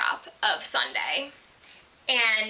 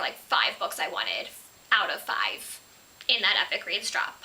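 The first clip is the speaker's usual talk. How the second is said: normal talking voice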